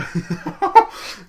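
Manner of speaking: squealing laugh at his own blunder; high burst of laughter. Real, not acted.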